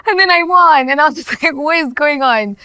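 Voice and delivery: loud, high excited recall with a laugh; thrilled laughing telling. Real, not acted. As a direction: relive the win with thrilled laughter.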